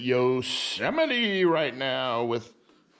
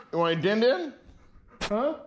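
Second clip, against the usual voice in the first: higher sing-song question for the dog; high dog-talk voice; 8 semitones higher than usual. Real, not acted. Offering Buster his dinner, high coaxing dog talk.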